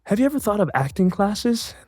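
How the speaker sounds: high, imitated lady's gentle question; delicate suggestion. Agency lady suggesting classes to a rejected actor, high delicate tone. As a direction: break it gently in a high mimicked voice.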